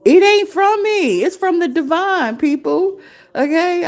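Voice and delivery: emphatic declaration. Real, not acted. To her viewers, insisting the idea came from the divine: ringing emphatic declaration.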